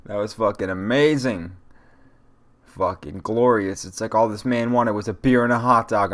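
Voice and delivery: ordinary talk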